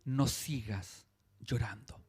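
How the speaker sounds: low, slow, gentle; tender consolation. Retold or imitated. Jesus consoling the grieving widow, low and tender. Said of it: "Y él le dice".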